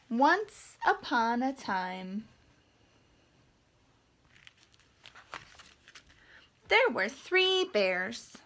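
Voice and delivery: slow, hushed start of a tale; once-upon-a-time voice; slow storybook opening. A narrator's reading. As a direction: draw the listeners in slowly.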